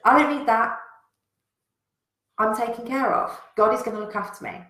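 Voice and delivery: casual brush-off. Retold or imitated. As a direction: wave them off without a care. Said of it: "And he kept saying".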